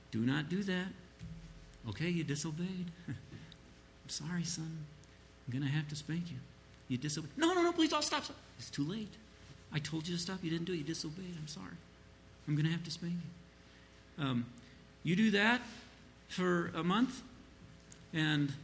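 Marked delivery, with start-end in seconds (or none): 0.1-7.3 soft, unhurried parental discipline; calm parent, no anger at all; calm and smiley. Acted. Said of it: "as calm, uh, and smiley as you want". 7.4-8.4 high-pitched frantic begging; mock panicky kid voice; child pleading. Child pleading to avoid a spanking. 8.6-14.2 soft, regretful firm parent; quiet, unbudging calm; calm and smiley. Acted. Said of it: "as calm, uh, and smiley as you want".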